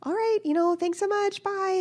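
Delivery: high, loud cheery goodbye; guy's cheery goodbye. Retold or imitated. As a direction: mimic the delivery guy's chipper thanks. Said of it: "and the guy said".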